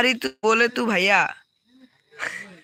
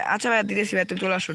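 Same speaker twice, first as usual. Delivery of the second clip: ordinary talk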